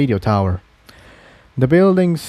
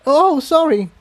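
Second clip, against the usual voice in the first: flustered quick apology, much higher; sputtered. Acted. Mare apologising after a collision, flustered. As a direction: caught off guard after bumping someone. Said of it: "Incendia sputtered".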